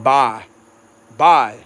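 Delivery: "Bye" for bayou, one quick syllable; "Bye" as Cajuns say bayou. Shown as a demonstration. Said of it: "It's basically like".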